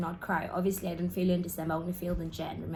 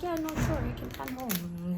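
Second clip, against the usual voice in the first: lightly voiced dad, higher and easygoing; a hint of a relaxed father agreeing; 5 semitones higher than usual. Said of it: "my dad was like".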